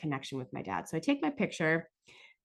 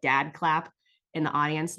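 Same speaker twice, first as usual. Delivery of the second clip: ordinary talk, nothing marked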